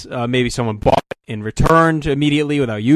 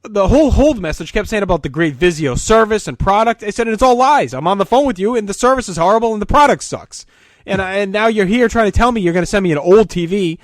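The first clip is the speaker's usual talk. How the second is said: heated, higher and louder retort; angry complaint; 7 semitones higher, 4 dB louder than usual. Retold or imitated. Son arguing with the Vizio manager, angry and raised. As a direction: snap back at the defensive manager.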